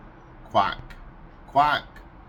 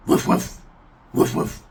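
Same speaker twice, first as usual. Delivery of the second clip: woof woof as a breathy unvoiced dog bark; dog bark impression; 6 dB louder than usual. Shown as a demonstration.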